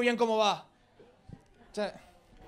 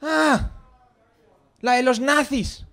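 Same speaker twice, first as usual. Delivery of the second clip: mock-confident realization, higher opening "Ah"; total conviction; 5 semitones higher, 3 dB louder, much slower than usual. Shown as a demonstration. Bluffing to a film snob, feigned total conviction. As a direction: answer as if you surely know it. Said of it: "con toda la convicción que tengas, di".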